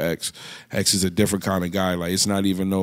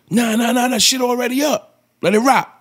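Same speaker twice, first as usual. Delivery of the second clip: high-pitched, raised imitation; retold insistent approval; 12 semitones higher, 3 dB louder than usual. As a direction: imitate a rapper waving off the question. Acts out rapper's approving reply.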